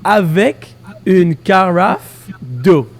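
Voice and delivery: very slow, careful French; every syllable stretched for the learner; over enunciating. Shown as a demonstration. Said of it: "your turn now".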